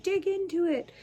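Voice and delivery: mock eager plea. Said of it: "you're like".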